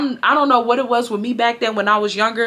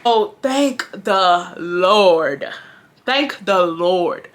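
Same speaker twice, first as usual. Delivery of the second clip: slow, drawn-out thanks; emphatic relief; 2 semitones lower, much slower than usual. Real, not acted. Teller relieved the car had left, emphatic. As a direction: stretch each word out in relief.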